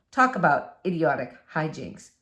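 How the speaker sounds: ordinary delivery, nothing marked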